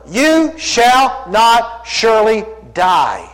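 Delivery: slow, every word weighed; deliberate slow quote of the serpent; drawn-out denial. Acted. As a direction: stretch the serpent's lie out slowly. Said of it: "denies God's word".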